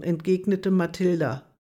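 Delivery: ordinary delivery, nothing marked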